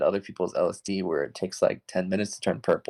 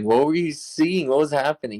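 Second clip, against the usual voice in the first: baffled questioning; 6 semitones higher than usual. Retold or imitated. Baffled questioning of sobered friend, retold.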